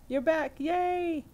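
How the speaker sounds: high, loud welcome back; delighted cheer. Real, not acted. Host greets returning viewers, delighted high cheer. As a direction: celebrate with a long high "yay".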